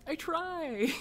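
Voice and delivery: high-pitched mock whine; playfully defensive. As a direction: plead your case in a high, pouty voice. Defending herself to teasing driver.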